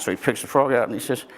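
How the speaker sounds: ordinary talk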